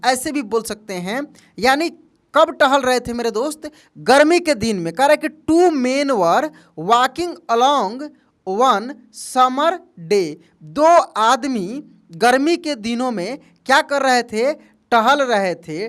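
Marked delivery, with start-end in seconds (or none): 5.3-10.3 slow dictation. Shown as a demonstration. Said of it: "dictating slowly".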